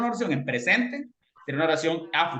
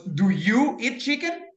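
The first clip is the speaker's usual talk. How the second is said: slow teaching demo; 4 semitones higher, much slower than usual. Shown as a demonstration.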